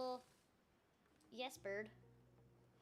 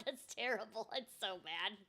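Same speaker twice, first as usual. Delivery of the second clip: ordinary talk, nothing marked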